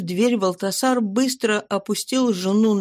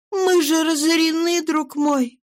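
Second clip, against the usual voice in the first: higher woman's voice, worried and searching; pleading wife; 8 semitones higher than usual. A narrator's reading. Wife questions her changed husband, higher worried voice. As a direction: plead gently as an anxious wife.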